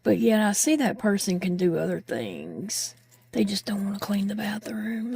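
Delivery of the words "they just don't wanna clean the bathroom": conspiratorial whisper; whispered aside. Real, not acted. Confiding to viewers that people avoid cleaning, whispering. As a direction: let them in on a secret, under your breath.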